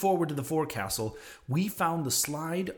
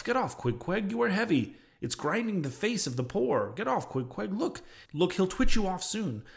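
higher-pitched, mildly agitated urging; urgent protest; 6 semitones higher than usual. A narrator's reading. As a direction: urge a friend off, a little alarmed. Urging friend off the sleeper.

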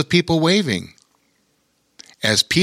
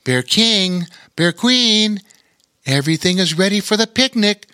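higher, eager storybook bear voice; reporting bear; 8 semitones higher than usual. A narrator's reading. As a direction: report eagerly to king and queen. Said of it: "reported the Lair Bear".

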